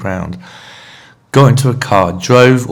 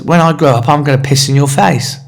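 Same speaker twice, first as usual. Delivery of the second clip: pitched higher, a lightly voiced little girl; mock little girl; 6 semitones higher, 3 dB louder than usual. Teller imitating a little girl threatening him, lightly higher. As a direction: imitate the cheeky kid's threat, sweetly higher. Little girl's shocking reply.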